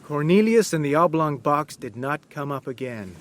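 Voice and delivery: plain reading voice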